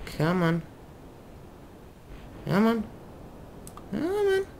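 soft, climbing squeak of a plea; tiny high begging voice; high-pitched coaxing. Real, not acted. Coaxing the craft to turn.

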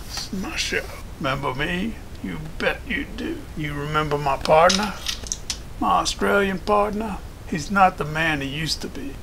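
put-on cowboy voice, cocky and possessive; cowboy showman Flint. Flint claims the show and introduces his partner, cowboy swagger. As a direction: play a swaggering Western host.

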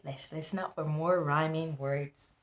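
ordinary talk, nothing marked

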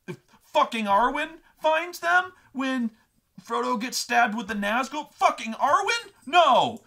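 high, loud, outraged disbelief; hammed-up furious protest; red rage rant. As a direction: imitate a brother yelling in indignation. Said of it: "going into the Red Rage".

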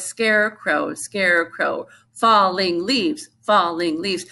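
slow, evenly spaced syllables; measured rhythm-teaching delivery. Shown as a demonstration. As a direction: sound out each part of the word for a class. Rhythm syllables demonstrated slowly.

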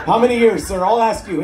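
ordinary delivery

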